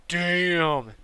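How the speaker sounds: impressed exclamation. Retold or imitated. Buddy reacting to a huge vape cloud, impressed. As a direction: imitate a buddy wowed, stretching the word.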